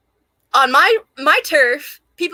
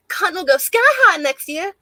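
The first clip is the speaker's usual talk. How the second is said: movie quote; 6 semitones higher than usual. Retold or imitated. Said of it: "quote a lot of lines from that fucking movie".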